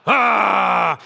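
loud burst of a yell; mock angry yell. Preacher acting out the rage he would rather feel, loud mock angry yell. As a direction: explode with a mock angry roar. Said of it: "I was thinking more like".

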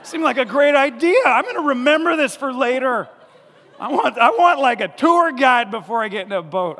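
high loud sarcastic grumble; sarcastic Thomas. Retold or imitated. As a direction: grumble it like a doubter. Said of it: "He's like".

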